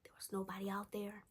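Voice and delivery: near-whispered, very soft; hushed suspense. Real, not acted. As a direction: drop to an eerie hush for the reveal. Suspense as lights appear behind them.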